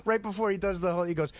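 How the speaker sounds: ordinary delivery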